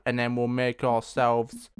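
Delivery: usual speaking voice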